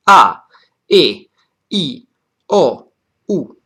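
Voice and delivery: A, E, I, O, U each hit hard with a falling pitch, separated by pauses; stressed vowels. Shown as a demonstration. Teacher listing the accented vowels, each stressed and separate. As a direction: punch each vowel A, E, I, O, U on its own. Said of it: "main stress. So the vowels on which".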